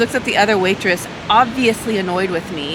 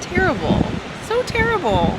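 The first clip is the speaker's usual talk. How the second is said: exaggerated dismay; 9 semitones higher than usual. Real, not acted. Vlogger venting to viewers about the cafe, high dramatic whine. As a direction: wail it like it ruined your morning.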